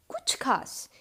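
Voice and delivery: ordinary talk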